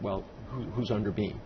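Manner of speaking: ordinary talk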